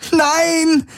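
put-on girl screaming as the guards seize her. A narrator's reading. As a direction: shriek it in protest. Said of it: "schrie Kirai".

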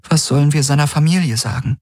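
quick, high woman's voice; troubled Kirai. Acted. Kirai asks Galen about their friend's family, high and troubled. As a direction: ask it high and worried.

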